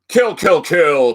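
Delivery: booming hype yell, very high; monster truck ad shout. Acted. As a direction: scream it like a rally announcer. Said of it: "read that like those old monster truck ads".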